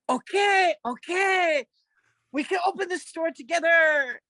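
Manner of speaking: high, loud imitation of an exasperated boss; boss giving in. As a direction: give in loudly as the pouted-at boss. Boss giving in to her pout.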